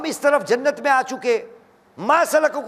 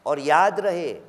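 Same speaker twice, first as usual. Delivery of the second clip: rising and falling sermon delivery with chanted Quranic verses; impassioned preaching; 5 semitones lower than usual. Acted. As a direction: preach it to the gathering, swelling on the key lines. Majlis preaching throughout.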